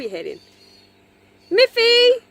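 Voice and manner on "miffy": very high, loud call of the name. Real, not acted. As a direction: call the dog across the yard. Calling the dog.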